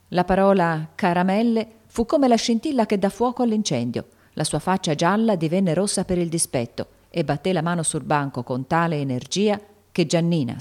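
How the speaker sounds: usual speaking voice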